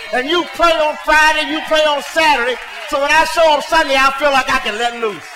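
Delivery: loud, driving preacher's exhortation; fiery preaching. Acted. Pastor urging the church to pray before Sunday, loud preaching. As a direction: push it hard at the congregation.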